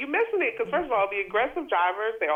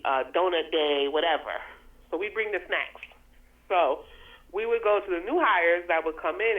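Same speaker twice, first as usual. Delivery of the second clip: ordinary delivery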